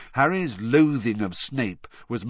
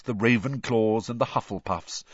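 ordinary delivery, nothing marked

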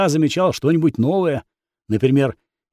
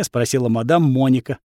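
ordinary delivery, nothing marked

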